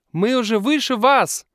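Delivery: put-on high, loud little girl's shout. A narrator's reading. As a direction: call down to them proudly. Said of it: "кричит маленькая девочка".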